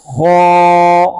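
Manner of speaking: खौ recited heavy and full from the throat, loud; heavy recitation. Shown as a demonstration. Teacher showing students the heavy letter, loud recitation. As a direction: make खौ thick and drawn out. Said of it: "इसको मोटा ज़रूर करना है".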